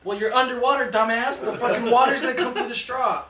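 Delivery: high, loud mock scolding through laughter; laughing self-scold. Scolding his past self underwater, laughing loudly. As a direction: berate yourself while laughing.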